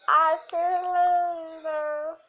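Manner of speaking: slow, high, loud gushing; drawn-out delight. Real, not acted. Gushing over the finished room, slow high delighted cry. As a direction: stretch it out, thrilled.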